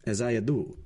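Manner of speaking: cold, calm clerical voice; measured and unmoved, a priest's voice. Acted. The Abbé coolly answering the magistrate, calm and even. The Abbé Busoni speaks calmly.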